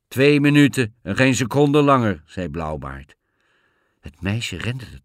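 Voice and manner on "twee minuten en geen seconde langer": loud, raised, forceful ultimatum; stern command. A narrator's reading. Bluebeard grants the girl two minutes, loud and stern. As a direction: lay down the limit hard.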